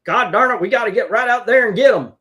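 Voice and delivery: loud, high Southern holler; hammed-up riled Southerner rallying the troops; Southern accent. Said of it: "gave him a Southern accent".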